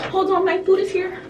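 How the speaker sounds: high-pitched excited announcement; excited interjection. Real, not acted. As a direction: break off, voice jumping up for the food delivery. Food delivery arrives mid-filming.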